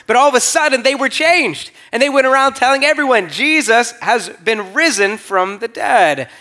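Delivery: rousing proclamation. Real, not acted. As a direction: lift it up and proclaim it to the crowd. Climax, disciples proclaiming the resurrection.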